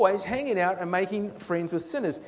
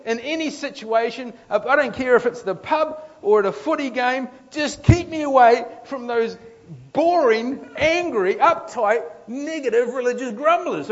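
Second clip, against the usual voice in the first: loud, higher, building exasperation; exasperated rant; 6 semitones higher, 7 dB louder than usual. Pretend. Sinner ranting about grumblers, loud and exasperated. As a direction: vent like you can't stand them. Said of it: "They're like".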